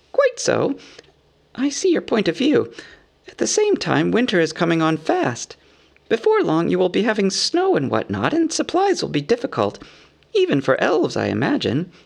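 high, polite hobbit voice; concede, then press the point in a high voice; agreeable hobbit negotiator. A narrator's reading. Bilbo warns of winter, politely businesslike.

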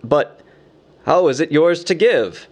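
mildly effortful questioning. A narrator's reading. Dazed Bard asks Bilbo, with an effort. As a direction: bring the question out with some effort. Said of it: "he asked at last with an effort".

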